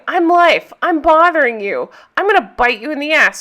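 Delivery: punchy, hammed-up nagging; playful pestering. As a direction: imitate a friend forcefully pestering someone. Retold friend pestering her out of self-pity.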